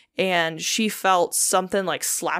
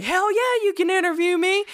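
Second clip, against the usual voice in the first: eager agreeing; 11 semitones higher than usual. Retold or imitated.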